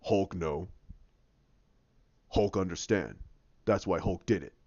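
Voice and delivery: low, soft imitated monster; deep, subdued broken-English brute; Hulk. Hulk explaining himself to Banner, low subdued imitation. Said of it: "And he goes".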